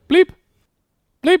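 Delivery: high bleeping imitation; bleep noise voice. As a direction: beep like a test tape. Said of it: "And Mister Wilcox would just go".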